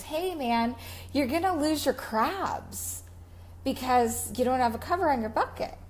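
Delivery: calling over to the crab catcher; retold warning. Fisherman warned about crabs.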